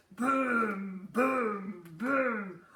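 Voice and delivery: loud, heavy thudding booms; booming footsteps. A narrator's reading. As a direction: boom it like heavy steps. Giant footsteps sound.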